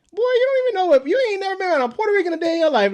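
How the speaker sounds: high, loud, rushed putdown; mock sisterly scolding. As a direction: imitate a sister dismissing a silly kid brother. Imitates sister scolding him.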